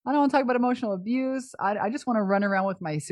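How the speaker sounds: high-pitched whiny wail, acted out; mock crying. Guest replaying her plea against talking about abuse, mock crying. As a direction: protest in a hammed-up tearful whine. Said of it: "I started crying because I was like".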